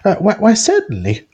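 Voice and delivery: high, fluttery nervous shopkeeper voice; wiry woman. Acted. Game master voicing the flustered smith's wife. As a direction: play her flustered and eager to please.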